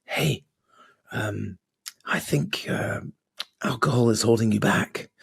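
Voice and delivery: a small nagging voice in your head; mock whisper of a tempting inner voice; little whispering voice. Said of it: "little whispering voice that goes".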